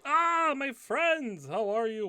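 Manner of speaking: high, effusive welcome that settles as it goes; hearty host welcoming back regulars; delighted innkeeper greeting. Acted. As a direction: greet old friends with a big rising burst. Innkeeper greets returning guests.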